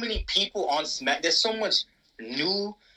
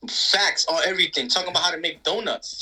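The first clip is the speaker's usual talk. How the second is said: normal talking voice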